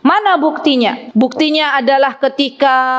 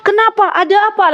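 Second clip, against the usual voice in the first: mock panicked wife; 7 semitones higher than usual. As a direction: play a wife who panics at once. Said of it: "Langsung panik".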